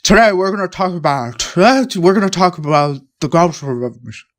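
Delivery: mimicked gruff, jowly lecturer; teacher trailing off. Teacher introducing history topic to class, trailing off. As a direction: lecture gruffly and let the ending blur. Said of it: "You would talk like that".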